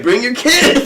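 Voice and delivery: high, laughing invitation; mock-enthusiastic urging; eager prankster. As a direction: egg them on through laughter. Prankster eagerly urges them.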